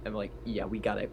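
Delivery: normal talking voice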